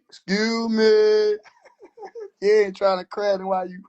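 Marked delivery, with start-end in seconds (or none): 0.1-1.4 slow mocking excuse me with a laugh; laughing, drawn-out excuse me. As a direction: stretch excuse me out very slowly, laughing.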